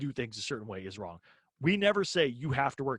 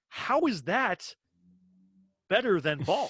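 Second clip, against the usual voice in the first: high, drawn-out, loud disbelief; incredulous retort; 6 semitones higher, 4 dB louder, slower than usual. Retold or imitated. Comedian retelling his reaction to a banned word, incredulous. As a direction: question it slowly, pitch leaping up in disbelief.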